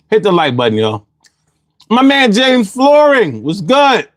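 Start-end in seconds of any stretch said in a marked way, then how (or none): 1.9-4.1 high, drawn-out hype greeting; excited greeting. Real, not acted. Streamer hyping up a chat member, excited greeting. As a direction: shout him out with big energy.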